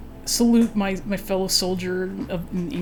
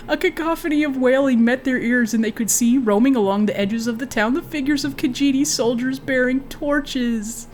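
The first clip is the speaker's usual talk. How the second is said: ordinary delivery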